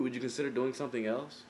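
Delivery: usual speaking voice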